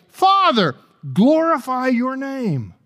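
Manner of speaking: a ringing, public plea; loud prayer. Acted. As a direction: cry it out to the Father, high and loud. Jesus's prayer voiced loudly.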